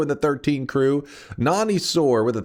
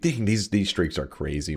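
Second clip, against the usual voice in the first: normal talking voice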